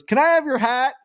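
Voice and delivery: put-on high little kid voice; kid asking. Golfer imitating a kid asking for his hat, high and small. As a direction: ask sweetly like a small boy.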